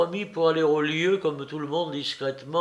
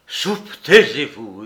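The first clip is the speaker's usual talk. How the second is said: Soupe, taisez-vous crié fort; hurle-lui de se taire; hurlement; 2 semitones lower, 7 dB louder, slower than usual. Acted. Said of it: "hurla Larrier". (Larrier hurle sur Soupe, voix forte)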